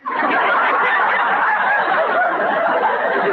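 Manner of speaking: high giggling fit; laughing at her own silly walk. Acted. Liz laughs at herself.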